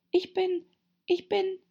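high, halting, unsure attempts; faltering. A narrator's reading. Alice struggling to tell the pigeon what she is, faltering. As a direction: stammer it high, stopping short.

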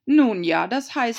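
Herzogin, ausweichend. A narrator's reading. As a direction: bluster and backpedal. Herzogin zögert. (Herzogin windet sich, lauter)

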